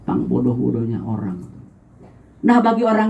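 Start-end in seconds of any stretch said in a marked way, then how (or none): none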